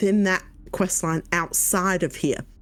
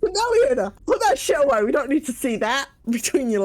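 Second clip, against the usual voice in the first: high, loud laughing tell-off; hammed-up scold, much louder; yelling a mock rebuke; 5 semitones higher than usual. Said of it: "You might as well".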